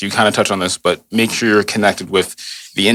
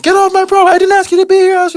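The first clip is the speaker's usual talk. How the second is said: imitated high-pitched, angry yell; 6 dB louder than usual. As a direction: mimic an upset homeowner shrieking at a trespasser. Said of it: "They start yelling at me".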